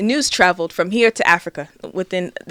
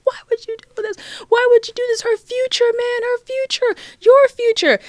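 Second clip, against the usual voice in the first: high-pitched imitation of a tearful wail; mock crying; 4 dB louder than usual. Imitating her crying brother confronting the baby's father, high mock wail. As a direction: wail it way up high, hammed-up pleading. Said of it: "And he's crying".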